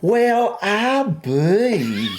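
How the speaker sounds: high, drawn-out mock Southern belle; hammed-up genteel Southern accent; upper-class Southern accent. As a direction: play a well-off Southern lady. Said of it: "Some Southern upper class shopper now".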